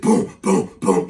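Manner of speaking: loud, punchy bass booms; imitated club bass. Storyteller showing the club music, loud imitated booms. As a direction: mimic thumping speakers.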